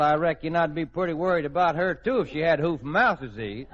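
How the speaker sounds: ordinary talk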